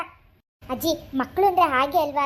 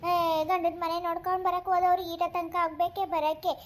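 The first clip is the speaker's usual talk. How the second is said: ordinary talk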